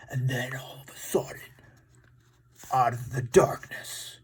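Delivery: hushed suspense whisper. Acted. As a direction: whisper it like a scary story. Suspense in dinosaur fight story.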